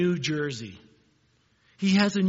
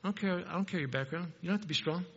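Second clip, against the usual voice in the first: tender God voice; 5 dB quieter, faster than usual. Retold or imitated. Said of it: "God looks out at us and he goes".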